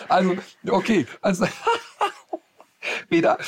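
words through laughter; fighting off a laugh. Real, not acted. Bursts out laughing at rule.

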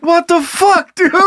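high-pitched exasperated disbelief; hammed-up frustration, pitched high; mock frustrated coworker. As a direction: exclaim it like a baffled, fed-up coworker. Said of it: "how frustrated his coworkers had to be".

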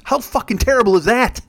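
usual speaking voice

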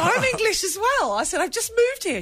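high, eager retelling; chirpy excited Brit. Retold or imitated. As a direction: pitch it up with delight. Excited retold reply.

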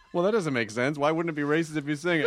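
low, puzzled mock skeptic voice; deep mock skeptic. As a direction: play the confused straight man.

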